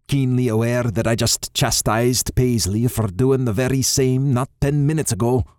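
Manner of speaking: usual speaking voice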